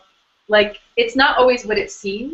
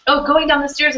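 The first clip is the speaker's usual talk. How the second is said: normal talking voice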